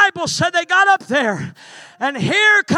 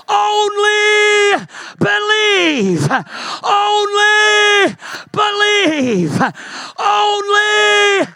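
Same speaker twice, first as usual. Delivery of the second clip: crying out; 5 semitones higher, much slower than usual. Acted. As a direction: stretch each word out as an urgent cry. Jesus pleads with Jairus.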